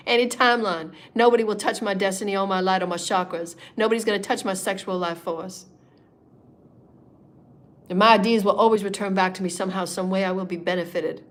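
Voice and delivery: usual speaking voice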